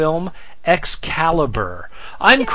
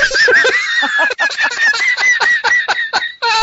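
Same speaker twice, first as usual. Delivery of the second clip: shrieking laugh. Real, not acted. Burst of laughter at own joke.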